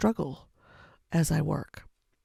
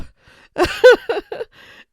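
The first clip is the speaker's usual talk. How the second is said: loud, high burst of laughter; self-amused laugh; 18 dB louder than usual. Real, not acted. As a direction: laugh out loud at your own slip. Laughs at own forgetfulness.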